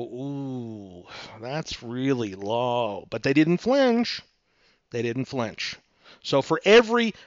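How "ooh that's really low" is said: slow, drawn-out ooh, softly begun; mildly pained reaction. Acted. Seller hearing a low offer, mildly pained reaction. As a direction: let the low offer sink in slowly.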